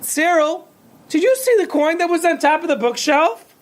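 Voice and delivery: a worried husband asks his wife; husband calling wife. A narrator's reading. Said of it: "He called his wife".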